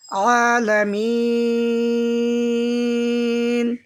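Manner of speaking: আলামিন drawn out with throaty ain; slow correct recitation. Shown as a demonstration. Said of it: "আইন অক্ষর উচ্চারণ করব".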